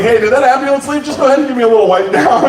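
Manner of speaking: high, quick mock plea; urgent request. Bouncer asks for a wipe down, high and hurried. Calling after ambulance for help.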